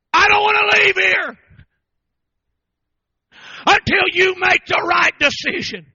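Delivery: choked, high pleading; tearful plea on his knees; pleading cry. Retold or imitated. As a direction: beg her through tears.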